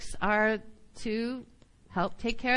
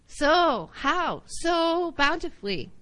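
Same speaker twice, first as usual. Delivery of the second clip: "Sow" hit higher and louder each time; stress on sow; 6 semitones higher, 4 dB louder, slower than usual. Shown as a demonstration. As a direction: punch "Sow" as the key word. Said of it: "Put the emphasis on heart and sow".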